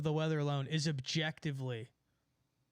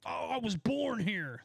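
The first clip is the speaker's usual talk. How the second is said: proud local mimic; 6 semitones higher, slower than usual. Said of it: "state pride is".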